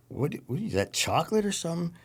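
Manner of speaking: high, puzzled question. Retold or imitated. To sick friend pointing at his pillow, puzzled. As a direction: ask in confusion, pitch climbing well up.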